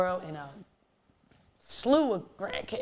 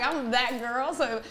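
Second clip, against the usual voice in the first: ordinary talk, nothing marked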